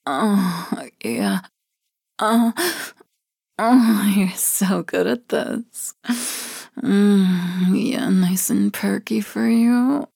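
ordinary delivery

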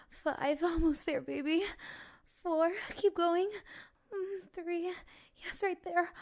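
high, loud, urgent panting delivery; breathless excitement. Pretend. Counting a lover down to zero, breathlessly excited. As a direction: build to an impassioned, gasping urging. Acted passionate countdown, building to climax.

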